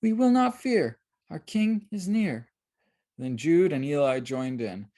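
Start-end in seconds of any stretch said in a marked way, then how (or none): 0.0-2.5 put-on girl voice. A narrator's reading. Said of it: "Mercy said it too".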